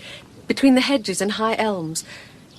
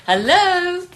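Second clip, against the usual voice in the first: louder, higher questioning call; 5 semitones higher, 7 dB louder than usual. Acted. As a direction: call into the dark house. Calling into empty cottage.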